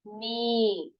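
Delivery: long नी with no k; नी as a held "nee"; silent k. Shown as a demonstration. Teacher answering own question, silent k. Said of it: "क्या पढ़ेंगे?".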